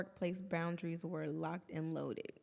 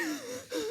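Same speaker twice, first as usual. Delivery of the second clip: high gasping sob, half laughing; a hammed-up sob as she retells it; mock sobbing gasp. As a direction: act out a breathless crying gasp. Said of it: "And I'm like".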